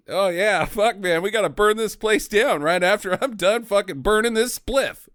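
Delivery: a hint of fired-up stoner; mock stoner rebel. Host mocking loaded reggae rebels, light stoner voice.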